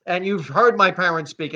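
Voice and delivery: ordinary talk, nothing marked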